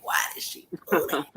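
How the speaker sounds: a shocked whisper under the breath; whispered panic. Retold or imitated. Embarrassed woman whispering to herself after the screenshot, panicked. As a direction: hiss it in panic, barely voiced.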